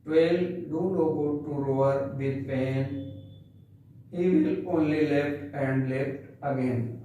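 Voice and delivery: very slow, measured poem reading; poem recitation. Shown as a demonstration. Teacher reciting the poem for class ten, slow recitation. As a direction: recite each phrase slowly with pauses.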